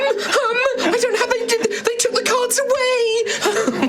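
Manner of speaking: high, giggling character voice; laughing high voice. Acted. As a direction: laugh through the words up high.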